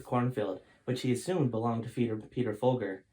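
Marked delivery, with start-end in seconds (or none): none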